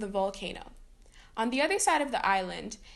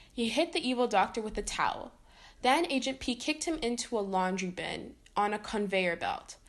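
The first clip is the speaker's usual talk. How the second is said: ordinary delivery, nothing marked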